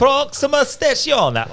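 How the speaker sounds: high, slow Italian announcer; mock Italian. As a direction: drawl it like an Italian station call. Echoing Italian station call.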